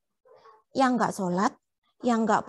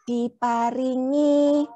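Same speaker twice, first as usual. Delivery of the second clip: slow prompt; 3 dB louder, much slower than usual. Shown as a demonstration. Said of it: "Matur nuwun".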